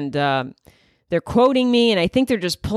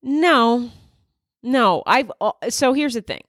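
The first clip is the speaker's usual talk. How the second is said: ordinary talk, nothing marked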